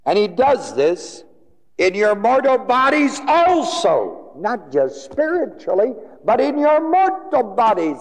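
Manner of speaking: very high, ringing pulpit cry; fervent preacher's proclamation; full-force preaching. Real, not acted. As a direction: hammer the verse home at the top of the range.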